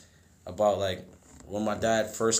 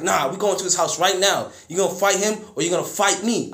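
angry dad; 7 semitones higher, 5 dB louder than usual. Retold or imitated. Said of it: "It was like".

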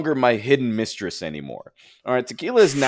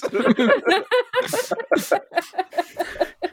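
high laugh. Real, not acted. Laughing at own stupidity.

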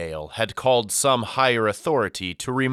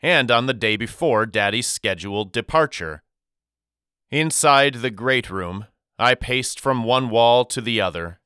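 usual speaking voice

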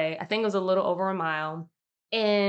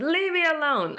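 high, louder mock snap; annoyed retort; 9 semitones higher, 3 dB louder than usual. Brushing off her partner's kind offer to stop, high and annoyed. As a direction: imitate an irritated brush-off. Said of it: "And I'm like".

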